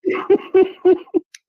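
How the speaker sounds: helpless high-pitched giggle burst; high laughing fit. Real, not acted. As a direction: crack up, can't hold it in. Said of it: "I can't stop laughing".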